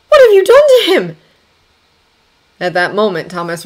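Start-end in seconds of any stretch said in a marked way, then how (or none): 0.1-1.2 high, loud, horrified question; alarmed cry. A narrator's reading. Daughter sees the man gone limp, alarmed cry. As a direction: cry out in shock. Distressed daughter turns on her rescuer.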